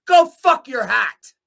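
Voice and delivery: angry shout. Real, not acted. Cursing the compensation board over threatening emails, loud. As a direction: spit it at them.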